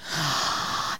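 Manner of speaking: soft, breathy intake of amazement; hushed mock gasp of delight; awed gasp. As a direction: gasp softly as if awestruck. Acting out tasting the snack.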